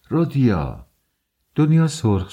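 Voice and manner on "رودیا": mild exclamation, pitched up then falling; mother's cry. A narrator's reading. Mother calling out to her son Rodia, mild cry. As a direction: cry out the name, without a full shout. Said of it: "پولشریا فریاد زد".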